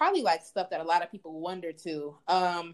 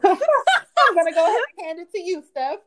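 higher, louder, bubbly laughing handoff; excited YouTuber voice; 9 semitones higher, 11 dB louder than usual. Acted. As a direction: hype it up like a YouTuber intro.

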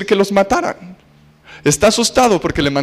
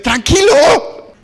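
mock soothing; 10 semitones higher, much slower than usual. Calming the dictator.

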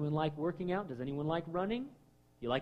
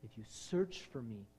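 soft voice of God; 4 semitones lower, 11 dB quieter than usual. Acted. God speaking softly.

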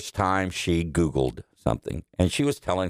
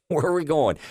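higher, puzzled mother's question; mom asking again; 8 semitones higher than usual. Retold or imitated. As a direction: ask innocently as forgetful mom.

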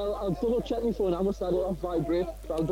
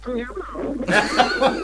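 louder, slow, laughing into phone; 5 semitones higher, 7 dB louder, much slower than usual. Real, not acted. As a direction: shout it slowly down the line. Repeat after bad phone line.

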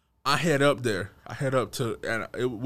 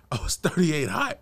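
laughing. Real, not acted. Repeating to his friend how mad he was, laughing. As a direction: crack up at your own line.

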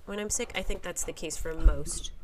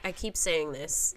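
usual speaking voice

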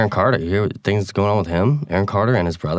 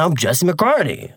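a slow, higher, smooth celebrity intro; self-introducing star; 6 semitones higher, slower than usual. Retold or imitated. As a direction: introduce yourself as the star, slowly. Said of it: "[name]'s like".

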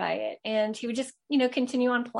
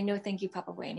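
gentle suggestion; 8 dB quieter than usual. Retold or imitated. Mother softly tells her son what to say. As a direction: model 'No, thank you, Papa Wayne' softly for a child. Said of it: "I go".

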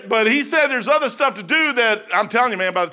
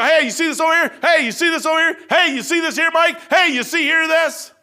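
excited pointing out; 8 semitones higher, 4 dB louder than usual. Retold or imitated. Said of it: "And then he go".

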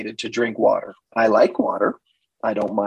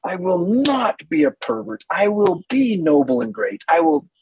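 higher, mock noble vow; proud vow; 8 semitones higher than usual. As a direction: declare it like a hammed-up knight. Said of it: "they say".

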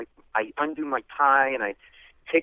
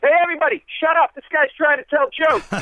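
much higher, louder shout to hush the room; drunk shouting to bar; 14 semitones higher, 6 dB louder than usual. Retold or imitated. Drunk silencing the bar, loud high shout. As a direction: bellow at the whole bar to shut up. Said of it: "he goes".

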